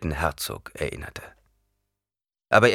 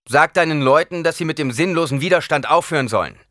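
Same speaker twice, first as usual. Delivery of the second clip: loud, higher command to stop; 9 semitones higher, 7 dB louder than usual. Acted. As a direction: order them to give up, loud and high. Paul orders surrender. (befehlend, laut und höher)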